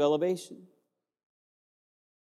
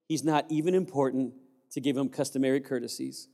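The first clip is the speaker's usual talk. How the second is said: ordinary delivery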